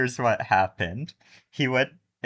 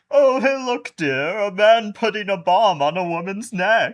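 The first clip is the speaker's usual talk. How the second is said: high, loud hammed-up socialite remark; mock society chatter, high and sing-song; rich couple; 10 semitones higher, 6 dB louder than usual. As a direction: play an oblivious rich passerby, pitched way up. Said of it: "like, a rich couple walking past and they're like".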